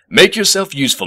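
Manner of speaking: gruff. Acted. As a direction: bark it out like a cranky professor. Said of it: "said the professor gruffly".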